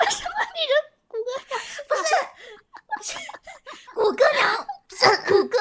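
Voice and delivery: high, loud words through laughter; laughing. Real, not acted. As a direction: talk while cracking up. Laughing at male TTS voice.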